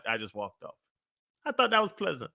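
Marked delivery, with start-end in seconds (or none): none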